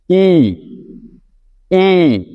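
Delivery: ই said as a long, clear ee sound; clear pronunciation. Shown as a demonstration. Teacher to students, modelling the letter ই. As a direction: drill the letter ই out for the class. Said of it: "উচ্চারণগুলো একটু ভালো করে খেয়াল করবেন".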